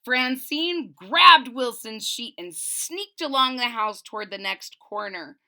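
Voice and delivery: usual speaking voice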